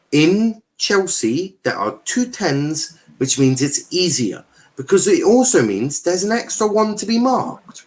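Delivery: slow and emphatic, so everyone follows; slow, deliberate explaining. Real, not acted. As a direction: spell it out slowly, point by point. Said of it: "Let me say this slowly so that everyone understands".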